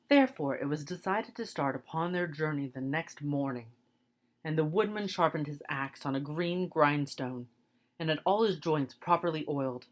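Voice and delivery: usual speaking voice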